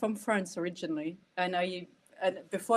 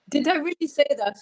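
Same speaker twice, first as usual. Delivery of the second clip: high, loud surprised question; taken aback by your own words; amused disbelief; 8 semitones higher, 8 dB louder, faster than usual. Real, not acted. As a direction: burst out in real amused disbelief. Startled at own quoted remark.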